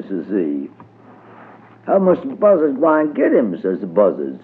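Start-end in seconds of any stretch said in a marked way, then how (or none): 1.9-3.6 high put-on questioning voice; imitating Brer Fox. A narrator's reading. Mister Buzzard questions Brer Rabbit, imitating a fox in a high voice. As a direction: ask it high, posing as someone else. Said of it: "tried to talk like Brer Fox".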